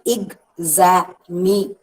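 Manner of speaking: "Examination" slowly as ex, a, mi, na, tion; syllable by syllable. Shown as a demonstration. Teacher showing five syllables to students, syllable by syllable. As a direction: break "Examination" into five separated beats. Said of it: "Five syllables are there".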